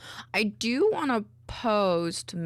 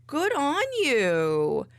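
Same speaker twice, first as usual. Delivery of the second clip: warm praise; 6 semitones higher, 3 dB louder, much slower than usual. Real, not acted. Praising viewer warmly.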